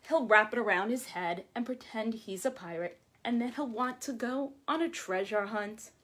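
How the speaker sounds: ordinary delivery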